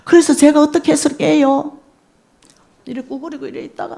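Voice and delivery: ordinary talk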